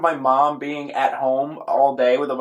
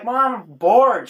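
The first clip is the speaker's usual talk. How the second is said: put-on high, drawn-out kid's complaint; slow, louder whine to mom; bored kid complaining; 7 semitones higher, 5 dB louder, much slower than usual. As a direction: play a bored child pestering mom. Retold child complaining to mom.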